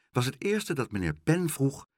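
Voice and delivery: ordinary delivery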